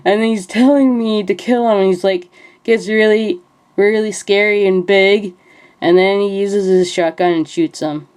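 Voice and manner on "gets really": ordinary talk, nothing marked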